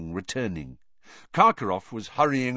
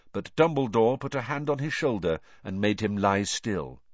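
ordinary delivery, nothing marked

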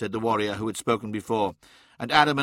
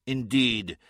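plain reading voice

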